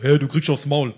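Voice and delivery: loud mock threat; acted threat. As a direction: threaten a beating, half in earnest. Acted threat from youths at door.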